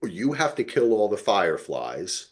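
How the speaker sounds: usual speaking voice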